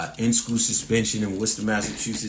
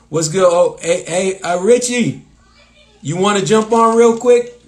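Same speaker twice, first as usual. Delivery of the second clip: loud, high-pitched hail; calling out; 7 semitones higher, 8 dB louder than usual. Real, not acted. Host inviting fellow comic Richie onto the live stream, calling out. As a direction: call out loudly to get someone's attention, pitch raised.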